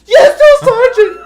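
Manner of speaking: high, loud shouted reply; recruit's shout. Retold or imitated. Recruit shouting back at the drill sergeant in the cafeteria. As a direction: scream it out like a scared recruit. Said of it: "He's like".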